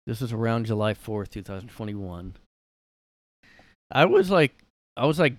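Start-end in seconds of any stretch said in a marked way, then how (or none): 3.9-5.4 excited. Real, not acted. Excited outburst.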